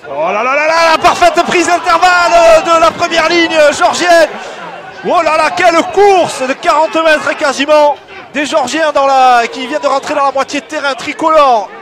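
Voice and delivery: excited play-by-play shout; high, loud, thrilled commentary; wrestling commentator. Real, not acted. Big Georgian break, commentator erupts.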